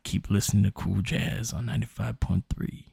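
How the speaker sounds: low, slow smoky late-night radio voice; noir detective. Retold or imitated. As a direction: croon it like a smoky jazz DJ. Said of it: "I lit a cigarette up just to try to sound like him".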